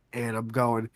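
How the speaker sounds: normal talking voice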